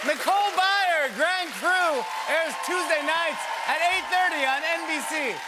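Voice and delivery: high, loud show sign-off; announcer plug. Real, not acted. Host closing the interview, shouted announcer plug. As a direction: shout the plug over the applause.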